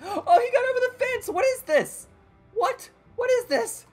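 high loud disbelieving yelp; shocked panic. Real, not acted. Enemy jumps fence.

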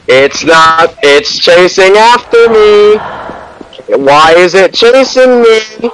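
high, slow panicked pleading; scared and begging while being chased; panicking gamer. Real, not acted. Monster chasing in game.

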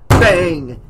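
loud, punched, falling blow; exclaimed bang. A narrator's reading. Old man's cane hits the floor, loud bang. Narrator voices the cane blows.

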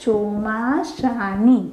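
drawn out slowly for writing; slow teacher dictating; slow dictation. Shown as a demonstration. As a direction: dictate the answer word slowly. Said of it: "તો જવાબમાં શું લખવાનું?".

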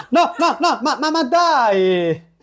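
high, panicked no's melting into a laugh; real frustrated panic turning to laughter. Real, not acted. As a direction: yelp as you get caught, then laugh it off. Caught again by mom.

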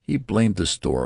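usual speaking voice

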